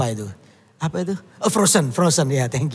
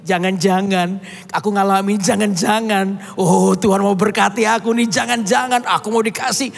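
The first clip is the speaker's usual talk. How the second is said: raised, fervent preacher's voice; preaching; 8 semitones higher than usual. Acted. Preacher urging the congregation to trust God, fervent. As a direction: drive the lesson home to the congregation. Preacher drives the lesson home.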